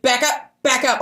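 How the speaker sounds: loud high retold shout; shouting back up. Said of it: "I kept telling her".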